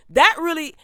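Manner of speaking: annoyed and raising the voice; elevated voice. Real, not acted. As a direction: voice rising as irritation takes over. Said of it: "my voice is getting elevated".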